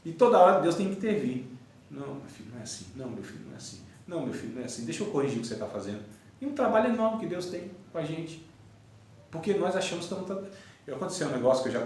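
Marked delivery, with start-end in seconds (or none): 1.9-6.0 hushed, low, patient fatherly voice; soft and low, as if gently stopping a child; quietly patient, under the breath; gentle correction. Acted.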